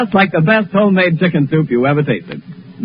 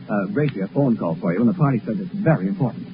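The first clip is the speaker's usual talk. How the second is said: quiet aside; 7 semitones lower, 8 dB quieter than usual. Real, not acted. Announcer relays phone call.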